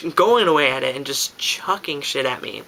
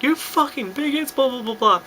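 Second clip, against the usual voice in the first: high mock yell at held-back volume; imitated drunken rant; 10 semitones higher than usual. Drunk speaker recapping the attack to a friend, mock outrage. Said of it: "I was just like".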